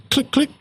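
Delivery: high, quick mechanical clicks; spoken click-click sound effect; imitated clicking. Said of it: "and then you hear a".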